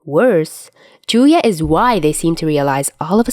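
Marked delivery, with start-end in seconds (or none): none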